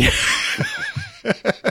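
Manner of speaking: high burst of laughter. Real, not acted. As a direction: let a big squealing laugh out. Host laughs at bad accent.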